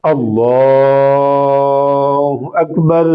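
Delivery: slow, soft, low recited prayer; prayer recitation demo. Shown as a demonstration. Preacher showing the congregation how to pray, slow soft recitation. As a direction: recite the prayer slowly as a practical demonstration. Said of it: "practical طریقہ بالکل آسان نماز اشراق کا".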